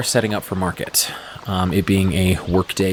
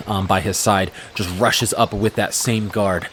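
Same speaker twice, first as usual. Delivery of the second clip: ordinary delivery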